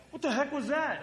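high, loud, exasperated rebuke; angry outburst. Retold or imitated. Player scolding teammate Tony behind him, angry outburst. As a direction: lay into him mid-game. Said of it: "Just laid into him".

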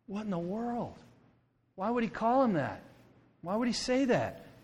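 high-pitched bafflement; incredulous. Acted. Preacher baffled by what Jesus said, incredulous. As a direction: question it in hushed, high disbelief. Acting baffled reaction to the verse.

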